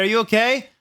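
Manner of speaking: high, loud call of his name; crying out. A narrator's reading. Calling to crying Draco outside, high and loud. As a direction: call out to him in alarm. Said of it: "I cried".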